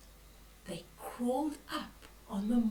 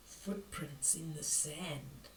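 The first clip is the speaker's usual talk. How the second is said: slow, much softer and lower; hushed suspense; 8 semitones lower, 11 dB quieter than usual. A narrator's reading. Granny building suspense for listening children, hushed and slow. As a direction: let the words sink in with dread.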